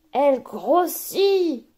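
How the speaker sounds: slow, stretched grossit; drawn-out growing. Acted. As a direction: draw out grossit as the bubble swells. Bubble swelling, word drawn out.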